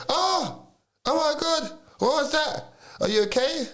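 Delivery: very high, shrieking panic; startled bystanders. Retold or imitated. Mimicking the people who heard the window smash, in high shrieking alarm. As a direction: squeal like startled onlookers.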